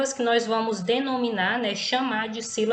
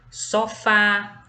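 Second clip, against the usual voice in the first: "sofá" with the last syllable FÁ louder; stress on final syllable; 4 dB louder than usual. Shown as a demonstration. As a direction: lean hard on "fá" in "sofá". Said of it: "ele é pronunciado com mais intensidade".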